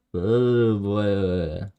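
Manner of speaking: normal talking voice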